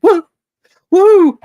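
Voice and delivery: loud, high whoop of joy; excited cheer. Real, not acted. Streamer celebrating the record audience with a loud whoop. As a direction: let out a real celebratory woo.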